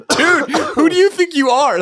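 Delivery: high voice through laughter; laughing. Retold or imitated. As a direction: squeal it while laughing.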